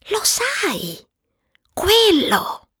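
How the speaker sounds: high, hushed, coy hint; hint at it softly, high-pitched; delicately skirting a taboo word. Acted. Hinting at an unmentionable subject.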